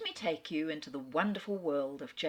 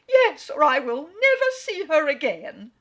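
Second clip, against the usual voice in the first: much higher and louder, a dramatic threat; frantic mother; 10 semitones higher, 14 dB louder than usual. Acted. The mother threatens Elizabeth, much higher, loud and frantic. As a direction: fling it out as an ultimatum. Mrs Bennet threatens her daughter.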